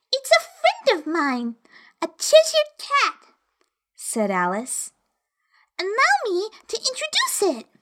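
put-on high, polite little girl; young girl voice. A narrator's reading. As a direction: introduce a friend politely. Alice introduces the cat.

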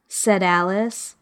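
ordinary delivery

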